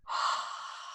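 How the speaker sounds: quiet unvoiced sigh out; just breathe it all out softly; soft breathy sigh. Shown as a demonstration. Guide exhaling with the listener, soft quiet sigh. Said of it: "comfortable breath".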